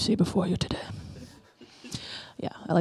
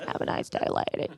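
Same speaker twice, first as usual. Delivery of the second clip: quiet guy voice; 10 dB quieter than usual. Retold or imitated. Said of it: "He's like".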